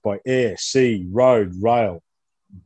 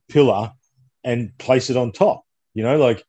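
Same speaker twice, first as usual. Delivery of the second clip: ordinary talk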